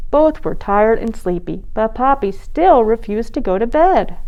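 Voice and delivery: ordinary delivery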